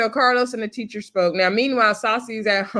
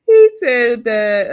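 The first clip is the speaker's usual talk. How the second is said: laughing; 4 semitones higher, 9 dB louder, much slower than usual. Real, not acted. Laughs at Carlos's pre-K claim.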